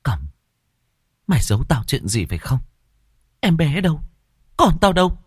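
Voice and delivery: put-on a frightened mother demanding her child; young mother's panic climbing as she demands her baby; trembling voice. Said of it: "giọng điệu lộ rõ sự run rẩy".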